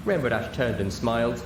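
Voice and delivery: ordinary delivery, nothing marked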